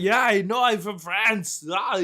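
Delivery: put-on high-pitched, botched Austrian-French accent; mock a terrible foreign accent; hammed-up bad Schwarzenegger-style French accent; Arnold Schwarzenegger. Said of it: "it was such a bad accent, like an Arnold Schwarzenegger".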